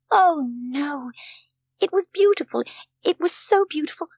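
put-on high, loud, impassioned child; young Heidi, overcome. A narrator's reading. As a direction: protest with swelling homesick emotion. Heidi, near tears, remembers the Alm.

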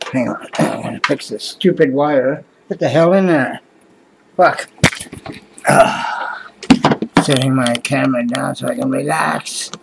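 ordinary delivery

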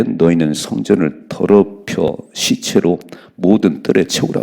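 loud, slow, weighty reading of the verse; scripture reading. Acted. Preacher reading the verse loudly to his congregation. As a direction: proclaim the verse slowly to the congregation.

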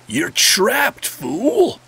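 drawn-out, gloating villain taunt, pitch leaping high; hammed-up evil gloat, rising high on the last word; mock villain. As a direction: playfully crow like a villain springing a trap. Gamer mock-taunts cow lured in.

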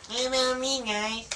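very high, whiny plea; whiny complaint. Pretend. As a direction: squeal it like a left-out kid. Echoing the left-out friend's complaint.

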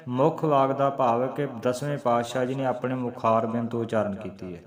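ordinary talk, nothing marked

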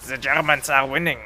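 line in a lightly thick German accent, z for th. Shown as a demonstration. Chat asks him for it, a light thick German accent. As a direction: say it like a German, mildly heavy German accent. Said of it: "say that with a thickest German accent".

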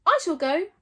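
volunteer grandly like a hero; noble and dignified. Acted. Gobber volunteering before the tribe, nobly and loudly. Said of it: "trying to sound noble and dignified".

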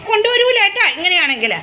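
hoger en luider, berispend tegen het dier; scolding the pet. Real, not acted. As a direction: wijs hem terecht.

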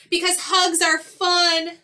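high, loud happy bear; bubbly storybook bear voice; cheerful bear. Acted. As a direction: sell it with big cheer. Happy bear urges hugs on skunk.

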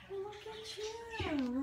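high, slow coo at a cat; high-pitched pet talk. Real, not acted. Cooing at a cat.

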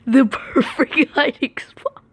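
giggling through the words; laughing. Real, not acted. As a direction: laugh it out, pleased. Repeats with laugh.

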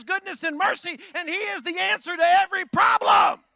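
high, loud, building sermon cry; preaching at full force. Real, not acted. Pastor preaching hard to the church, full-force loud. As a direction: whoop it up to the congregation.

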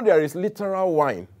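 usual speaking voice

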